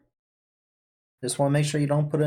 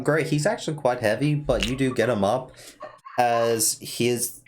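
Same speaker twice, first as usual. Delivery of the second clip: ordinary talk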